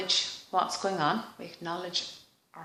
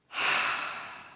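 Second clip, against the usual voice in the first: deep sigh. Real, not acted. Tapping session, releasing sigh.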